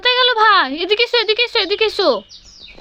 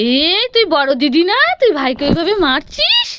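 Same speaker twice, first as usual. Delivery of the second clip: normal talking voice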